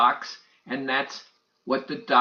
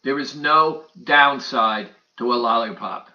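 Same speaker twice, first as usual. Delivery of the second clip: ordinary talk, nothing marked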